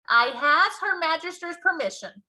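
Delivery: high, loud giant's exclamation; the big friendly giant calling out proudly; loud, high giant's cry; BFG crying out. A narrator's reading. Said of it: "cried the B F G".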